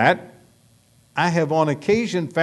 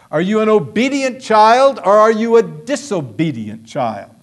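ordinary talk, nothing marked

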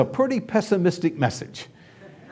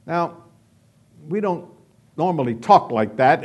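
ordinary delivery

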